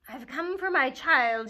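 triumphant witch cry; loud laughing witch. Acted. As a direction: boom it out gleefully. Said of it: "she laughed".

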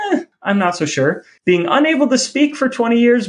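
ordinary delivery

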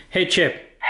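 high sing-song call to dog. Real, not acted. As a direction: call the dog's name. Calling commands to robot dog.

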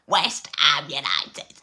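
put-on slow, drawn-out Cockney accent. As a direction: stretch it out in Cockney. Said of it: "I tried to do a Cockney accent".

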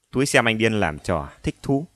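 ordinary delivery

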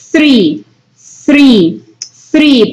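three with teeth grazing the tongue; three with a careful th; th sound. Shown as a demonstration. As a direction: let the top teeth brush the tongue on three. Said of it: "Sus dientes superiores pasan rozando su lengua".

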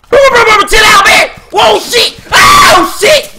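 very high, loud frantic shouts; shrieked burst of alarm; panicked screaming. Real, not acted. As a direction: scream for them to back off. Attacked in game.

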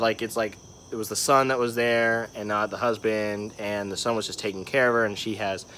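usual speaking voice

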